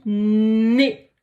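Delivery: On lit nez stretched slowly, nez rising; slow reading. Shown as a demonstration. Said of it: "Qu'est-ce qu'on lit?". (lecture lente de nez)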